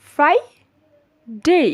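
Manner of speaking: "ফ্রাইডে" very slowly split, high "frai", pause, "dey"; lip-teeth f. Shown as a demonstration. Teacher demonstrating the f, slow split pronunciation. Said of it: "আমাদের উপরের দাঁত".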